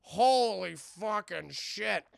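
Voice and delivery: slow, loud mock amazement; stunned awe. Gargoyles seeing Elisa transformed, acted stunned awe. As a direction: stretch out each word, dumbstruck.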